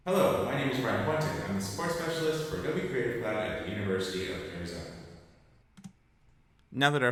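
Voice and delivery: echoing like a big hallway; big drum room reverb. Shown as a demonstration. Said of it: "like you were in a big hallway".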